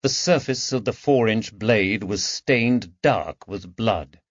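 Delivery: plain reading voice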